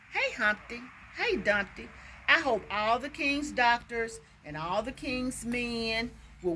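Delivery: rhythmic verse, opening high and gradually settling; poem recitation. Acted. Teacher reading her made-up Humpty poem, rhythmic recitation. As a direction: recite in bouncy nursery-rhyme phrases, easing down.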